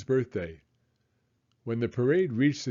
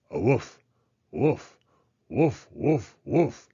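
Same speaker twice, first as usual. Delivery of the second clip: rhythmic barking; slower than usual. Acted. Clifford leads the parade, barking a marching rhythm. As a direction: bark in steady beat. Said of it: "Clifford's bark keeping the beat".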